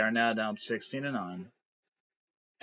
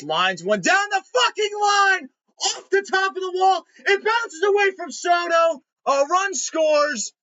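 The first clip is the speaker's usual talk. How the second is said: much higher, louder excited call of the hit; play-by-play shout; 8 dB louder than usual. Real, not acted. Fan shouting the play as the run scores, frantic excited call. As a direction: yell the double down the line like a frantic announcer.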